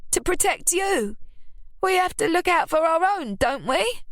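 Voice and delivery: high, self-righteous plea; Ruby's voice. A narrator's reading. Ruby justifying herself to her family. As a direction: play the wronged mother.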